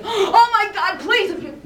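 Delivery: high, loud, laughing mock panic; hammed-up desperate plea to God, half laughing; joking imitation of a frantic prayer; panicked prayer. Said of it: "pray from that state of".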